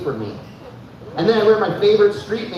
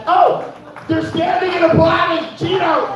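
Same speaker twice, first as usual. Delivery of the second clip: high shouted mock voice, much higher; 11 semitones higher than usual. Repeating an audience member, high mock shout. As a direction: squeal it like an excited fan. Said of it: "She's like".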